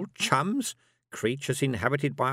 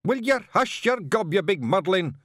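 higher, louder Scottish shout; furious Feegle shout; 7 semitones higher, 4 dB louder than usual. Acted. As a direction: shut him up angrily. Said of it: "shouted Rob Anybody".

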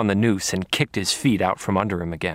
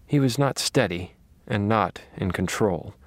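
ordinary delivery

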